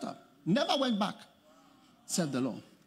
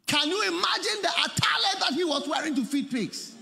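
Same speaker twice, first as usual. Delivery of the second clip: preaching shout; 12 semitones higher, 3 dB louder than usual. Acted. Repeated question, second shouted.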